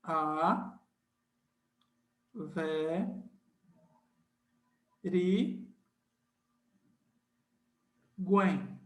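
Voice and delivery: teacher breaks averiguem into slow syllables. Shown as a demonstration. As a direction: spell it out syllable by syllable. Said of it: "Olha só uma palavra".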